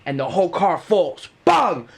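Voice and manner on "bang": loud high bang; imitated crash. Car drops off wheel, loud bang. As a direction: shout the crash.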